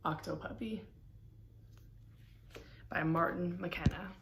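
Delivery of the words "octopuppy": plain reading voice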